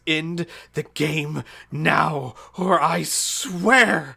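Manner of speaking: loud, high, slow demand; livid threat. Acted. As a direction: livid god issuing a threat. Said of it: "He looks livid and stamps his foot".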